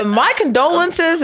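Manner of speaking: stiff, high, slow mock condolence; hammed-up formal sympathy. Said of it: "it just sounds so, formal".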